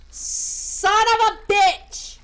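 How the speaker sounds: loud, high, drawn-out curse; exasperated shout. Real, not acted. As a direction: explode slowly on each word. Same curse erupts louder.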